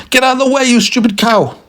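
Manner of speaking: very high-pitched furious yell; hammed-up irate driver; angry shouting. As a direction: screech an insult at whoever blocks the road. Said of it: "started shouting at the cow even louder".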